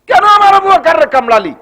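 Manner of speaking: high, loud accusing cry; shouted rebuke. Acted. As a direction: scold the snake at full preaching force. Prophet scolds the snake, acted.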